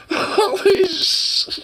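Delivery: high and slow, dissolving into real laughter; crying laughing. Real, not acted. Tears of laughter over the little Satan ball. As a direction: let the laugh swallow the words. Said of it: "straight fucking crying".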